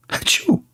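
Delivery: high, light mock achoo; little put-on sneeze, very high; fake sneeze. Fakes a sneeze mid-mockery.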